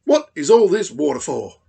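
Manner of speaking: fast, high and loud question; curious platypus. Acted. Platypus quizzes Dingo about the water, fast and loud. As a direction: pop the question out quickly.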